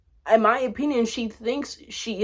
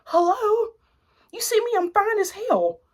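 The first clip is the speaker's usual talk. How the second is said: sassy brag; 11 semitones higher than usual. Real, not acted. Sassy self-praise.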